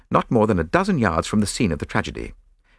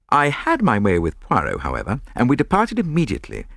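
normal talking voice

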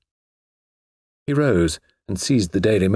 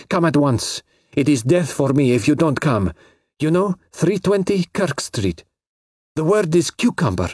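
frantic Carmen; 6 semitones higher than usual. Acted. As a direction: urge him in terror to come.

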